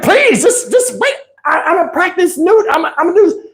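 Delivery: very high imitated woman's plea; hammed-up falsetto begging; mock pleading. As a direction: plead in a squeaky high voice, stumbling.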